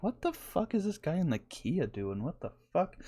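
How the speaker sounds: a hint of a baffled driver, mumbled; imagined baffled driver. Driver staring at the Kia, mock baffled mumble. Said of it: "thinking about the looks".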